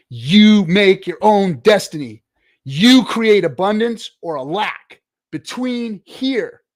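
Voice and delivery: loud, slow, emphatic declaration; forceful preaching. Real, not acted. Streamer lecturing a pessimistic viewer, loud slow emphatic. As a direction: hammer each word home loudly.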